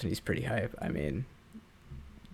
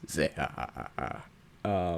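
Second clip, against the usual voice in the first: low, rough pirate cackle; Blackbeard laugh; 5 semitones lower, 5 dB quieter than usual. Retold or imitated.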